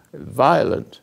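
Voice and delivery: usual speaking voice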